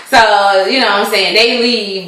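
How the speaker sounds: normal talking voice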